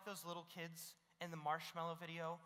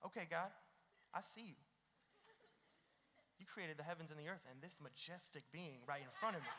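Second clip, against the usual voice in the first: mock swooning prayer; 5 semitones lower, 9 dB quieter than usual. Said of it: "And you just".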